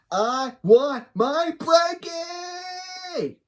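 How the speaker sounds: very high, drawn-out whiny wail; tantrum wail. Acted. Khloe demanding her blankie back, high tantrum wail. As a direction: wail it like a toddler's tantrum, dragging the last word. Voicing character's tantrum, menace yelling.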